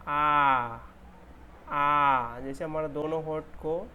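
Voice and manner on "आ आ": long open आ held out loud. Shown as a demonstration. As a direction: stretch आ with lips open wide. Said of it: "ये क्या है".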